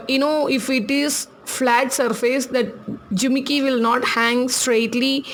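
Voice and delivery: ordinary talk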